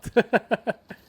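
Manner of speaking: nervous laugh. Real, not acted. Nervous laugh during game.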